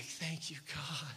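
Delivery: hushed, breathed-out pretend gratitude; comic under-the-breath thanks; mock relief. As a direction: drop very soft, imitating secretly relieved listeners. Imagined relieved congregation, for a laugh.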